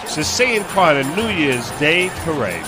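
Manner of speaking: normal talking voice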